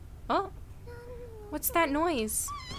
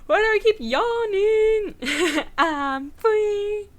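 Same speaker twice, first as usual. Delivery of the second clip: silly voice; 8 semitones higher, 5 dB louder, much slower than usual. Pretend. Silly high sing-song joke.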